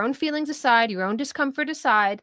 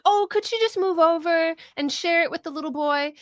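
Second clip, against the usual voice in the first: high-pitched parent asking a favor; pleading parents; 8 semitones higher than usual. Retold or imitated. Parents asking a girl to share a kiddie ride, high coaxing. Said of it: "the parents were like".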